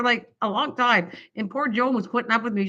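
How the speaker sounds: ordinary talk, nothing marked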